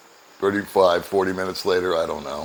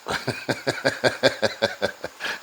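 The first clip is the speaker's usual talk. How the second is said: breathy laugh; 4 semitones higher, 5 dB quieter than usual. Real, not acted. Laughs retelling luggage mix-up.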